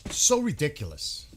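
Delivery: fed-up outburst at the news; loud exasperated sigh of a line. Real, not acted. Scoffs at diplomatic talk.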